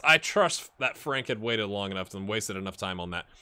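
ordinary delivery

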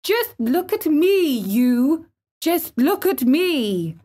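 high, insistent challenge; proud, high-pitched demand; flung out defiantly. A narrator's reading. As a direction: command him to look. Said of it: "he flung up at Ben Weatherstaff".

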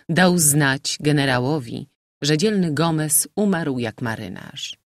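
usual speaking voice

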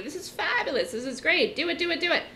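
imitating a breathless TV market pundit; hyped TV pundit. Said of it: "somebody on C N B C is telling you".

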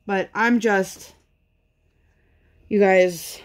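ordinary talk

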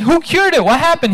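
high, urgent disbelieving questions; pressing for who did it, pitch jumping up; astonished king. Acted. King presses his courtier for the healer, high and urgent.

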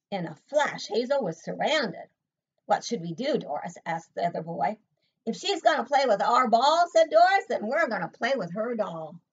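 lively, lilting picture-book reading; story read aloud. A narrator's reading. Reading Hazel's story aloud to a child, lively storytelling. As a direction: read it to a small child with bouncy emphasis.

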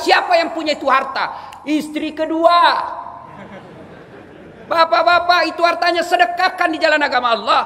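loud, high, impassioned exhortation; rousing sermon at full cry; full-force preaching. Real, not acted. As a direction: drive every question home to the congregation.

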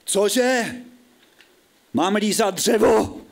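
high-pitched, outraged disbelief; incredulous. Acted. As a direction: balk at an absurd order. Narrator balks at the instruction.

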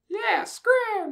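very high squeaky yell; tiny squeaky voice telling him to scram. Acted. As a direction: shriek it way up high. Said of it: "a much higher pitched voice screamed".

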